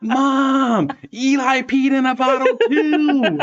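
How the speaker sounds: put-on high, drawn-out kid's holler; top-of-lungs yell. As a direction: imitate a child yelling across a parking lot. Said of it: "yelling at the top of his lungs".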